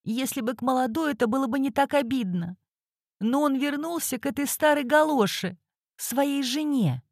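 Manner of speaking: high, bitter voice, slowing at the end; resentful woman venting about her rival; bitter jilted wife. Acted.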